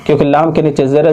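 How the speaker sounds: ordinary delivery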